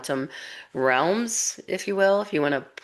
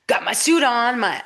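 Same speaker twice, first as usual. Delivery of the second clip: proud excited boast; 8 semitones higher, 6 dB louder than usual. Retold or imitated. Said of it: "and he's all".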